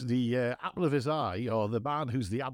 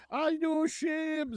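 mock shame; 13 semitones higher, much slower than usual. Retold moment of public embarrassment.

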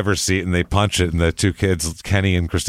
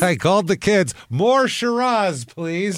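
high, drawn-out mock mom asking for more wine; wine-sipping mom; 9 semitones higher, slower than usual. Host mocks the mother ordering wine, high and slow. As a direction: stretch out "More Shiraz" lazily, pitched high. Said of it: "mocking the mom wanting wine".

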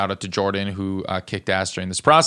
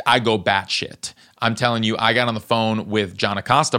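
ordinary talk, nothing marked

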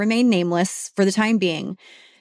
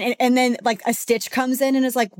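normal talking voice

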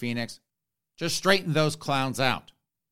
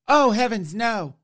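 high, loud mock-aghast bishop; startled bishop; 8 semitones higher, 5 dB louder than usual. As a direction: gasp it out like a frightened cleric. Bishop voiced in a sketch.

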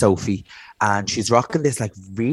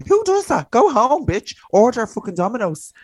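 much higher, louder, shrieking mock outrage; mock outraged yell; 12 semitones higher, 5 dB louder than usual. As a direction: scold her like a fed-up friend, squealing high. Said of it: "I'm like".

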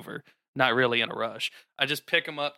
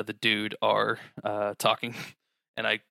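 ordinary delivery

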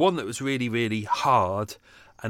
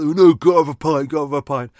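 higher, louder mock-eager voice; mocking pub-goers; 4 semitones higher, 10 dB louder than usual. Mocking pub-goers rushing out, louder mock-eager voice. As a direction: ham up a desperate pint-lover. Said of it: "they all rushed out".

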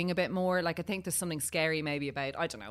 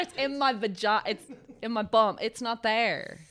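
slow, higher and emphatic; to her husband during labour, slow and exasperated; exasperated correction; 5 semitones higher, slower than usual. Retold or imitated. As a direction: spell it out to him slowly.

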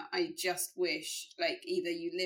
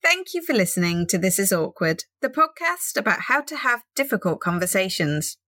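podcast outro; 14 dB louder than usual. Acted.

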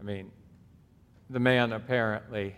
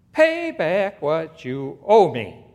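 slow, loud, higher-pitched demand; harsh demand; 7 semitones higher, 12 dB louder, slower than usual. Acted. Servant demanding money from his fellow servant, loud and slow. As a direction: lean on every word like a creditor.

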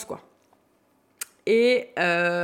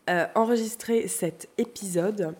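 ordinary talk, nothing marked

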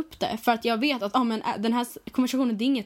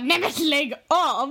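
drawn-out mock protest, higher pitched; retold outcry; 5 semitones higher, 4 dB louder, much slower than usual. Friend retells reaction to pickup line, exaggerated outcry. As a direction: exclaim it slowly in exaggerated disbelief.

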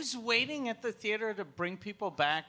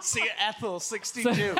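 real laughing delivery; 4 semitones higher, 5 dB louder than usual. Real, not acted. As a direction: joke pushed out through laughter. Joking through laughter.

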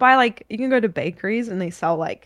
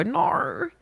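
put-on Queensland accent; 5 semitones higher than usual. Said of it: "the Australians that go".

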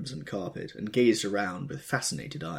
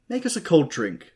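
high, louder eager call; Daisy crying out to her husband; cried out; 11 semitones higher, 8 dB louder than usual. A narrator's reading. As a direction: call out bright and demanding. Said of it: "cried Daisy".

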